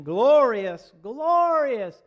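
very loud, high, drawn-out acclaim; exultant praise. Acted. Congregation come in to sing praise, exultant shout. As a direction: belt out the praise like a fervent congregation. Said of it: "us to come in here and sing".

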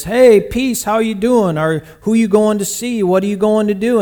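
a cheerful traveller hailing people on the road; friendly greeting. Acted. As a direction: call out cheerily to passers-by.

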